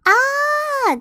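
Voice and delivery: usual speaking voice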